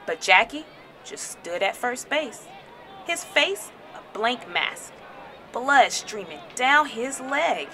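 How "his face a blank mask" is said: ordinary delivery, nothing marked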